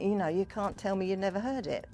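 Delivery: ordinary talk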